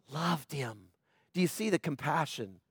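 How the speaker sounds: soft, tender preaching; tender hush. Real, not acted. Tender moment of compassion.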